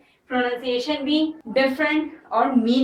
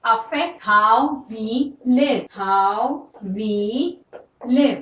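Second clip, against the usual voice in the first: very slow, word by word; slow dictation; much slower than usual. Shown as a demonstration. Teacher dictating the example sentence, very slow. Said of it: "new technologies continue to".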